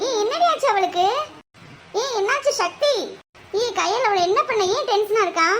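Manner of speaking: put-on high-pitched girlfriend voice, shocked; a startled friend asking what happened; alarmed friend. Another girl reacts, alarmed.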